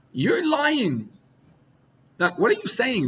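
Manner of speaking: mock-outraged, high-pitched protest; intense outrage.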